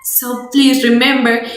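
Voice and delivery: normal talking voice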